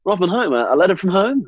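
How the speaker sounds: mock-jovial boss, pitched high; infantry boss impression. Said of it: "That's a good boss impression".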